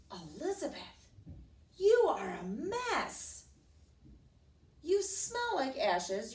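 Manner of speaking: high, drawn-out scolding; snotty disdain. Acted. Prince to his bedraggled rescuer, disdainful. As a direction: look down on her with distaste.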